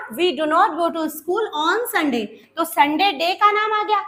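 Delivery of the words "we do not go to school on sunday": slow, a little softer, stress on not; slow teacher dictation. Shown as a demonstration. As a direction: read it out slowly for the class. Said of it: "तो यहाँ पर क्या आ गया?".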